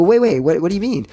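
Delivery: mock alarm at the doctor; retold nervous protest. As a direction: replay a mild panic, stalling. Retelling nervous reaction before laser surgery.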